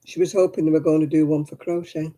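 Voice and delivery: talking while crunching crisps; eating crisps between words; crunching chips. Real, not acted. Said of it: "Sorry if I'm crunching".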